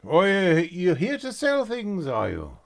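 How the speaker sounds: high, loud, jovial greeting of a large man; big trader's voice. Acted. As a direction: boom out a friendly merchant welcome.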